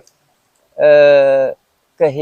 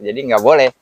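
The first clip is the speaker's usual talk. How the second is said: ordinary talk, nothing marked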